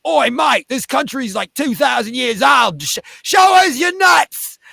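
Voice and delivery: loud, high yelled heckle, slowing at the end; rowdy Aussie heckler. Retold or imitated. Australian crowd member heckling the comic, loud and high. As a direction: bellow it like a drunk punter.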